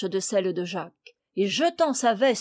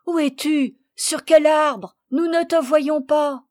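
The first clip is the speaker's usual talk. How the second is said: high, loud, anxious calling; raised, urgent cry; 5 semitones higher, 7 dB louder than usual. A narrator's reading. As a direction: shout questions up at the trees. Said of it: "cria Camille".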